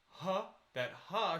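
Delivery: breathy huh; diaphragm huh. Shown as a demonstration. Coach showing the onset, breathy huh. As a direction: puff it out from the diaphragm. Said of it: "as soon as you".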